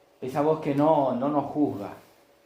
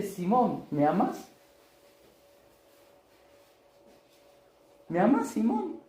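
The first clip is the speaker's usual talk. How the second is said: higher, drawn-out tender asking; pleading question; 11 semitones higher, slower than usual. Acted. Jesus asks Simon as an equal whether he loves him, rising and pleading. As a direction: ask as an equal, almost begging. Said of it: "Lo trata como igual a igual".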